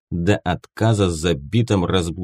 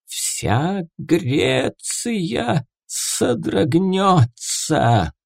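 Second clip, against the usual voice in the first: high, very slow hissed prophecy; hissing prophetess; 10 semitones higher, much slower than usual. Acted. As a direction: hiss it like a possessed seer. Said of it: "прошипела Пифия".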